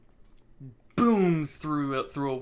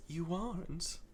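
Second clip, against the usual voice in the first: a quiet, menacing phantom's tease; sinister Starline; 10 dB quieter than usual. Retold or imitated. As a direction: purr it like a cool, taunting phantom. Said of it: "And Starline says".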